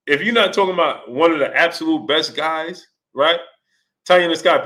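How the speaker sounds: usual speaking voice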